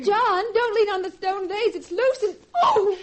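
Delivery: high, loud, rising panic; alarmed warning. Acted. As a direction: warn him, then cry out. Warning as vase falls.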